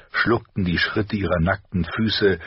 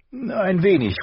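very high-pitched mother; mother's voice; 14 semitones higher than usual. A narrator's reading. Wife admits little son is afraid, high mother's voice. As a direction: pitch it high for the wife's concession.

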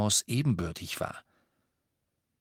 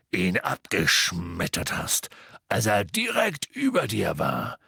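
big friend boasting about Harry; gruff giant. Acted. Hagrid praises Harry.

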